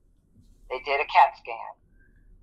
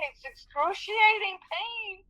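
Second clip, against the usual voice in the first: much higher, slow, tearful moan; crying; 4 dB quieter, slower than usual. Retold or imitated. Injured worker tells her supervisor how much it hurts, crying. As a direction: draw it out in tears. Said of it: "I just was bawling".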